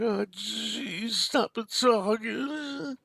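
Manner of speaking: a put-upon Archie Bunker whine; whining Archie voice. Retold or imitated. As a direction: drag it out like a grumbling Archie. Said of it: "do that whining Archie voice".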